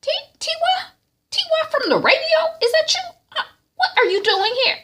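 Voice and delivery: very high, stunned questions; startled recognition. Retold or imitated. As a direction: recognise the radio star. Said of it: "the wife is like".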